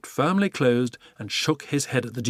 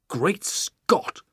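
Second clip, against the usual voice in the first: chief shouting; 7 semitones higher than usual. Acted. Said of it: "shouted the chief of police".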